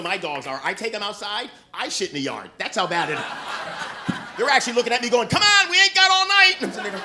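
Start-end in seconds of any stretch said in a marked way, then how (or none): 5.3-6.5 loud, high-pitched hurry-up holler; impatient yell. Acted. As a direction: holler like a dog fed up with waiting. Dogs impatiently yell at owner.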